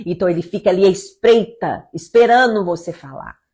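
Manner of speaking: ordinary talk, nothing marked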